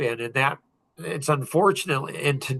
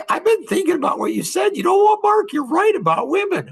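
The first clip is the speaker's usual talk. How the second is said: mock high-pitched mom voice, louder at the end; imitating mom; 4 dB louder than usual. Mom admitting to her son he was right, high imitated voice. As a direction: act out mom conceding, pitched way up. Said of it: "we'd be talking, and she's".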